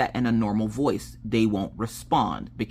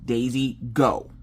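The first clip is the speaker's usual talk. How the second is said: firm; slower than usual. Shown as a demonstration. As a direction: give a controlled command, no yelling. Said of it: "Being firm".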